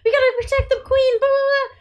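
high frantic bee voices; buzzing insect swarm chorus. Acted. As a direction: rush it high and loud like panicked bees. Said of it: "buzzing voices going around and around".

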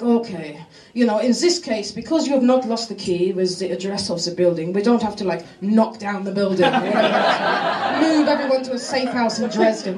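deadpan corporate pronouncement, voiced low; mock managers. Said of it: "They're like".

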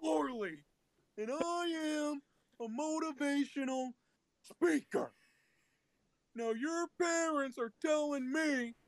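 high, slow, strained speaker voice; Chris Farley's motivational speaker. Acted. Doing the SNL skit for friends, hammed-up motivational speaker. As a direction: draw each phrase out high and emphatic. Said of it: "Just, just do it".

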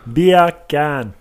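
"beer can" as Jamaican "bacon"; two drawn beats, Jamaican "beer can"; Jamaican accent. Shown as a demonstration. Showing co-host the joke, Jamaican accent. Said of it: "beer can is the accent".

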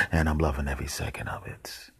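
soft, hushed delight; gleeful whisper. Acted. Watching the spider eat flies, whispered glee. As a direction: whisper it like a naughty secret.

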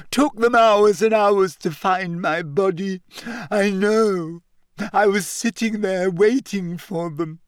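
high, wailing ghost telling her woes; whiny, high-pitched lament; self-pitying ghost girl.

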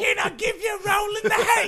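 high, loud put-on streetwalker voice; mock Cockney prostitute. Co-host plays a London prostitute propositioning a gent, high and shouty.

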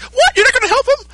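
high, incredulous squeak; a stunned, shrill outburst of disbelief; shocked Bulma. Retold or imitated. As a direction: Bulma aghast that he won't help.

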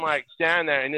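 usual speaking voice

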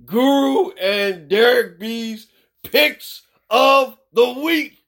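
slow, high segment announcement; announcer echo. Pretend. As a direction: draw out the title, then echo the last word. Announces segment with a self-made echo.

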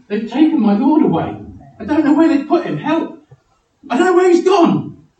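high, loud frantic cries; hammed-up distress. Preacher acting Mary at the empty tomb, frantic distress. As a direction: wail it out in frantic mock panic. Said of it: "and she's saying".

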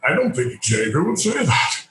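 slow, measured hint of Patrick Stewart. Star actor quipping behind the storyboard artist, slow and dry. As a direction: lightly voice the star's dry remark.

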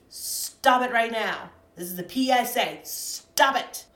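loud, slow stern command; loud scolding. Real, not acted. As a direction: scold them like a PSA. Ranting PSA finale.

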